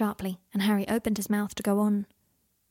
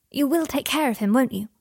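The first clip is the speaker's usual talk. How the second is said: lightly voiced child, higher and earnest; serious boy; 5 semitones higher, 5 dB louder than usual. A narrator's reading. Scorpius asks Harry to care for his dad, earnest. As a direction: press the question with childlike seriousness. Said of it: "looking suddenly serious".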